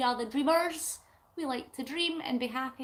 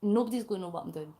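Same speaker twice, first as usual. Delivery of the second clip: normal talking voice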